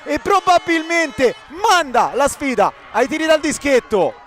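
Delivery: full-throated excited goal shout; breathless italian goal commentary. Real, not acted. Goal call in live commentary.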